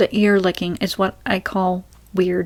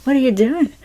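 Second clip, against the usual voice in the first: a hint of mom, higher-pitched; imitating mom's puzzled question; 5 semitones higher, 4 dB louder than usual. Repeating mom's reaction on finding the filming, lightly imitated. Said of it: "She says, just like that too".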